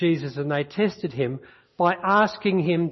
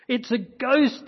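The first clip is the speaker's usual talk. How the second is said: frightened cry; 7 semitones higher, slower than usual. A narrator's reading. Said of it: "cried out in fear".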